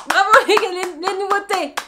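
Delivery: loud, high congratulations; high, rousing cheer; cheering bravo. Pretend. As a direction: applaud them with a big shout. Applauding the new products.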